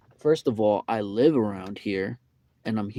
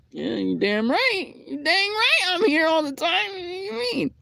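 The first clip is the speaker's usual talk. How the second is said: whiny outraged voice. Retold or imitated. Mocking himself arguing with the employee, high whine. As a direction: wail it like a wronged customer. Retelling his own reply, whiny.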